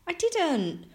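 put-on high-pitched boyish protest; protesting cry. A narrator's reading. Franklin denying it to his parents, protesting cry. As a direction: cry out a denial. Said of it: "Franklin cried".

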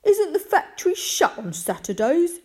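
loud, high, incredulous question; frowning challenge. A narrator's reading. Visitor doubting the boy about the factory, loud and disbelieving. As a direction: challenge it loudly, pitch way up.

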